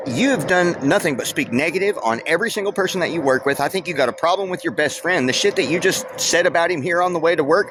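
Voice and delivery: replaying how he let the coworker have it; retold telling off.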